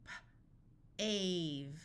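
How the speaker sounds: puffed P, pause, then "ave"; sounded out. Shown as a demonstration. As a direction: break "P, ave" into separate sounds. Phonics teacher sounds out, then blends.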